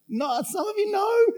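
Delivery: sudden high shout to the crowd. Real, not acted. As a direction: call it out high and loud.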